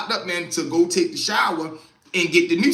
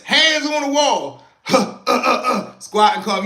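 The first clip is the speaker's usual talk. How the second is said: mock officer; 5 semitones higher, 4 dB louder than usual. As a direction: drag it out like a showy cop. Cop fakes extra search.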